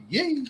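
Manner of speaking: squeaky high-pitched yay; high yay shout. Real, not acted. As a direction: yelp it out high like a celebration. Signature sign-off yell.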